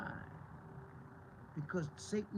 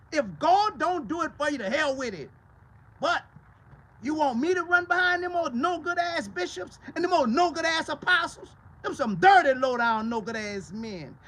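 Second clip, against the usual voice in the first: loud, high, fiery preaching; preaching at full force; 8 semitones higher, 12 dB louder than usual. Real, not acted. Preacher railing against bishops and apostles, at full force. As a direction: build the sermon to a roar.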